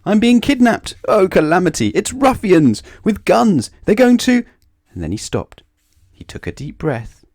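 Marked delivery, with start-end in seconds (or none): 0.1-4.4 high, loud hysterical outcry; frantic terror, cut off mid-sentence; panicked screaming. A narrator's reading. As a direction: scream each wild fear louder than life. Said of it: "he screamed".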